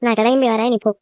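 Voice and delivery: usual speaking voice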